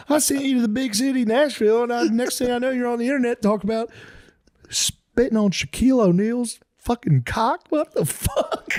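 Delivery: very high, wailing southern dad; hammed-up hysterical lament; mock-distraught father. Father berates daughter he sent to Nashville, hysterically upset.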